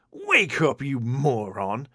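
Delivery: high screech then a slow sneer; witch screech. A narrator's reading. Evil empress screeching at her sleepy warrior. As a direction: Rita the witch jabbing her warrior awake. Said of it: "she screeched".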